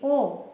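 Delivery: ओ said with the mouth a little open, rounded; demo vowel ओ. Shown as a demonstration. Teacher demonstrating ओ to students, rounded vowel. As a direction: model the short rounded ओ for students. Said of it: "इसको बोलते समय थोड़ा सा मुंह खुलता है".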